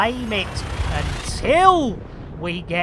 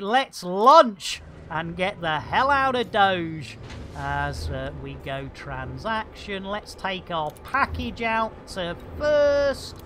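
ordinary delivery

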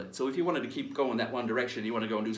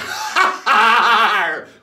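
big hearty hammed-up pirate laugh, louder and higher; 9 semitones higher, 12 dB louder than usual. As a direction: throw back your head and roar out a pirate laugh. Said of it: "stand and laugh like a pirate".

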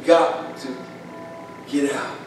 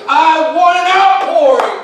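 high, loud, slow cry of longing; crying out to God; 7 dB louder, slower than usual. Retold or imitated. Preacher retells his garage prayer, crying out loud. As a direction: cry the words out to heaven. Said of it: "I'm crying out to God".

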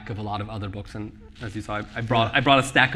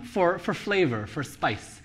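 ordinary talk

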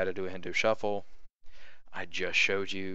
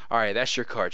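firm, assured and louder; confident, in charge; 2 semitones higher, 4 dB louder than usual. Shown as a demonstration. Magician presenting the forced card, bold and sure. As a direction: own the moment, no doubt at all. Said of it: "you wanna have the right attitude".

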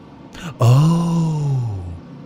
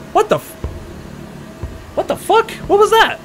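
very high, startled squeal; shocked disbelief. Real, not acted. Player startled by what just happened, shocked disbelief.

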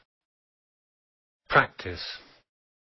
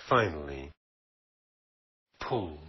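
ordinary delivery

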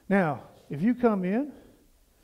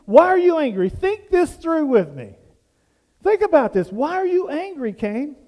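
higher, louder pleading question; God pressing Cain; 6 semitones higher, 5 dB louder than usual. Retold or imitated. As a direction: press him to think it through. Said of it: "he says".

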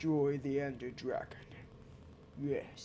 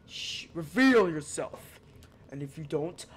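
dramatic command; 6 dB louder than usual. Pretend. Commanding the hidden cave.

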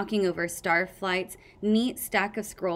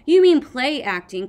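loud, scornful growl, pitched up; 6 semitones higher, 11 dB louder than usual. A narrator's reading. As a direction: throw it back at them, loud and cross. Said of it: "Kestrel growled".

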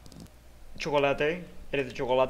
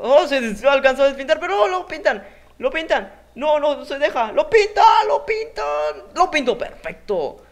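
high, loud frantic play-by-play; excited yelling; 12 semitones higher, 10 dB louder than usual. Real, not acted. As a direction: shout it as the action unfolds. Excited live commentary of play.

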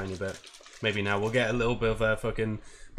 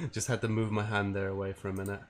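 ordinary talk, nothing marked

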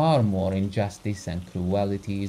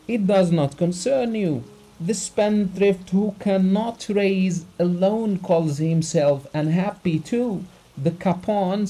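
high, loud, sneering dismissal; the doctor scorning the rich as idle capons; contemptuous scorn; 9 semitones higher, 4 dB louder than usual. A narrator's reading. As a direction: pour scorn on him.

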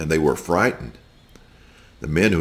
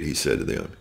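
ordinary delivery, nothing marked

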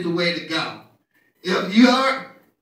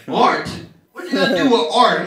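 incredulous dad; 5 dB louder than usual. Retold or imitated. Dad incredulous about art major.

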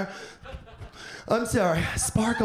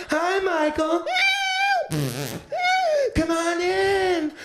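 put-on higher, slow old lady greeting; sweet old Nana; 7 semitones higher, slower than usual. Nana greeting grandson at nursing home, high and slow. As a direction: welcome him in like a frail grandma. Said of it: "visit Nana at the nursing home".

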